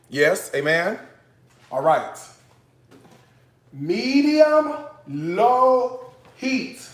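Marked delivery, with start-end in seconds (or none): 3.7-6.9 high, drawn-out teacher's chant; call-and-response prompt. Shown as a demonstration. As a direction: stretch each word so viewers can join in. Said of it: "Say it with me".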